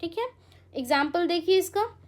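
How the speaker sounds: ordinary delivery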